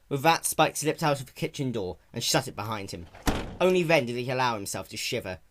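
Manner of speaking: ordinary delivery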